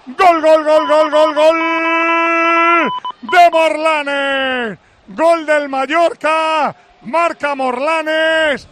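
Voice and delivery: loud repeated goal cry, drawn out; goal shout. Real, not acted. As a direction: scream the goals out loud. Goal scored, commentator calls.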